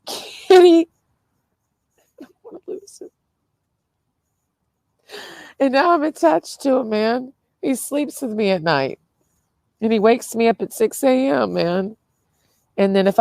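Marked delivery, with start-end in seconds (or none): 0.0-0.9 breaking voice. Real, not acted. Tearful about her cat. 2.2-3.1 high, soft and breaking, on the verge of sobbing; tearful, choking up over the cat; crying. Real, not acted. Tearful about her cat. 5.6-12.0 still teary. Real, not acted. Crying over her cat.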